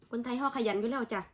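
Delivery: normal talking voice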